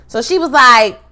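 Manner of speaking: ordinary talk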